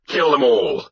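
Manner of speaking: high, forceful battle cry; shouted. Acted. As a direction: shout it to rouse the warriors. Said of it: "I shouted".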